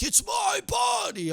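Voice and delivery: high, slow shrieking yell of "it's my body"; mock scream. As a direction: screech it like a hammed-up tantrum. Mock rant in the steam room.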